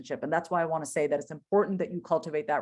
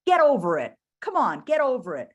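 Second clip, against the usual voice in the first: louder, impatient mock scold; a scolding inner critic, louder; harsh self-scolding; 5 semitones higher, 5 dB louder than usual. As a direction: bark at yourself to get over it. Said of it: "This is not the time for me to be like".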